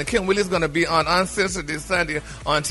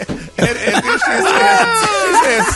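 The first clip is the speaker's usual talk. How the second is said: laughing; 12 semitones higher, 3 dB louder, slower than usual. Real, not acted. Cracking up mid-story.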